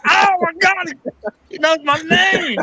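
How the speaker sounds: high, breathless fangirl squeal; squealing over a celebrity; starstruck gush. Retold or imitated. Starstruck fan gushing.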